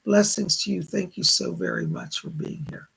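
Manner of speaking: ordinary talk, nothing marked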